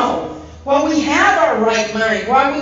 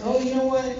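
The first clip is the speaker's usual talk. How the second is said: usual speaking voice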